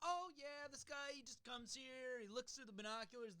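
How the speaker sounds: high, slow imitation of a breezy woman; nonchalant lady. Co-host mimics the barber woman's nonchalance, high-pitched imitation. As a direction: play her unbothered, pitched well up. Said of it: "the nonchalance with the way this lady".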